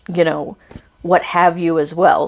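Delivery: usual speaking voice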